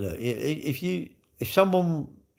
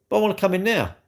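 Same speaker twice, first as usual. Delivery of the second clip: mock protest; 6 semitones higher, faster than usual. Acted customer protests at being refused.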